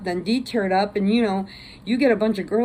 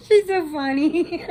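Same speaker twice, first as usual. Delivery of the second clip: laughing; 9 semitones higher, 3 dB louder than usual. Real, not acted. Laughing after the story.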